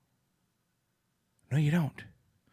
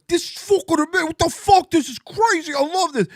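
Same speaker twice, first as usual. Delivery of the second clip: much higher, thrilled freak-out; excited New Yorker; 4 dB louder than usual. Retold or imitated. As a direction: gush like a New Yorker amazed by freedom.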